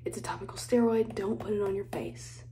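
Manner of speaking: soft conspiratorial whisper. Real, not acted. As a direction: hush it like a confession. Whispered skincare warning.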